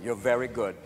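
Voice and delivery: low, softened admission; grudging doubter. Acted. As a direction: concede it low and quietly.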